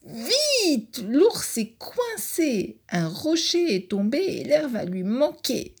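tiny high voice, urgent and slow; ant crying for help. Acted. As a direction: squeak out the alarm. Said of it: "appelle au secours".

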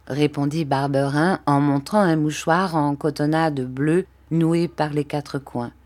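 ordinary delivery, nothing marked

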